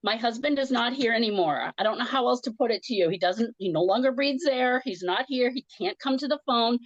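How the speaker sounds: worked-up, insistent flood of words; retold angry rant. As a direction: rant as if flipping out on the phone. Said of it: "I just got really angry".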